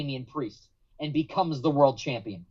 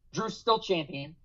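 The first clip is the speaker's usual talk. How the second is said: ordinary talk, nothing marked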